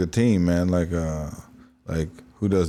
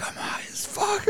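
high whisper; 8 dB quieter, slower than usual. Retold or imitated. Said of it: "She's like".

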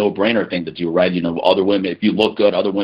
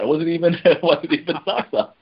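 giggling through the words; laughing while speaking; laughing while talking; 6 semitones higher than usual. Real, not acted. Teasing joke, laughing while speaking.

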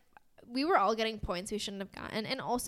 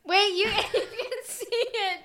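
giggling; 10 semitones higher, 3 dB louder, slower than usual. Real, not acted. Caught hiding something, giggles.

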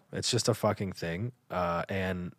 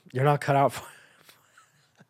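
laughing whisper; 4 semitones higher, much faster than usual. Real, not acted. Laughing aside to listeners.

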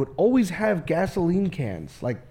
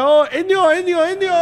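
high fond grandpa call; 9 semitones higher, 5 dB louder than usual. Retold or imitated. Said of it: "he's like".

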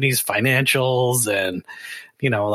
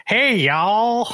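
high, hammed-up Southern drawl; exaggerated Southern greeting; 8 semitones higher than usual. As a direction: call it out like a friendly Southerner, pitched way up. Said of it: "use the Southern drawl".

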